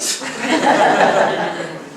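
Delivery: a real, loud, high burst of laughter. Real, not acted. Laughing at name mix-up.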